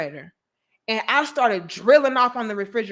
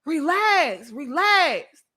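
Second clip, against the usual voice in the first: higher calming brother voice; imitating brother soothing, pitched up; 7 semitones higher, much slower than usual. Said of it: "And he said".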